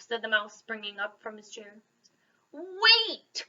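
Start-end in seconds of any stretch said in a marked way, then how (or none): 2.5-3.5 loud high call; crying out. A narrator's reading. Bear stops the mouse leaving, crying out. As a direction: call him back urgently. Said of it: "cried Bear".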